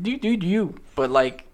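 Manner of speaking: ordinary delivery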